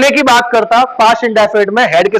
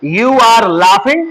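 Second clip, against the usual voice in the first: very slow, drawn-out English words; slow teacher's model sentence; slow dictation; much slower than usual. Shown as a demonstration. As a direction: spell out the translation word by word. Teacher dictates English translation slowly.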